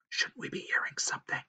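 soft chipmunk hiss; hushed, hissing whisper. A narrator's reading. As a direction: whisper it, barely voiced. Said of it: "hissed Huggledug".

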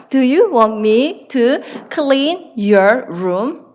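very slow, word by word; slow dictation. Shown as a demonstration. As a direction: spell it out slowly for students to write. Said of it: "이렇게 얘기를 하시면 되죠".

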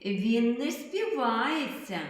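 normal talking voice

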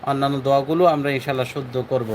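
normal talking voice

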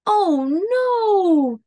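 high, dismayed cry; dismayed scream. Acted. Mole and Bear watching their kite spin away, high cry of alarm. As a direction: read it as a storybook gasp of alarm. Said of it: "screamed Mole and Bear".

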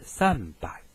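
Japanese "三百" read san-bai; "三百" as san-bai, b after the n; voiced B, sanbai. Shown as a demonstration. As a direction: voice the h of hai to b in "三百". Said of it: "所以产生浊音变".

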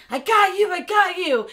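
high, loud, hammed-up urgency; mock-urgent rescue cry. Jack holding slipping Rose over railing, mock-urgent cry. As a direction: yell it like you're hauling someone up.

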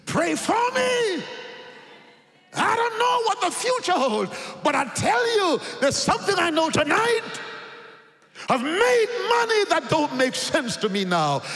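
high, loud plea; converted man begging prayers, loud and high; impassioned testimony shout. Retold or imitated. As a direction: cry it out to the congregation. Converted drug dealer testifies.

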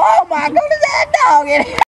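high squealing. Acted. Screaming on phone.

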